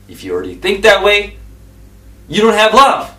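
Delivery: loud pulpit commands; fiery preaching. Acted. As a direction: preach each command hard. Preaching at full force.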